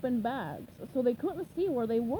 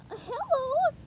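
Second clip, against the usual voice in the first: high cheery polite hello; polite SpongeBob; 14 semitones higher, 3 dB louder than usual. Acted. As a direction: greet politely as a cartoon sponge. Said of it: "SpongeBob said politely".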